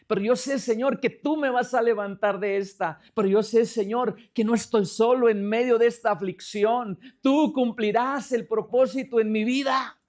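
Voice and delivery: rising, emphatic declaration to God; retold prayer of firm trust; renewed faith. Retells regaining strength in prayer.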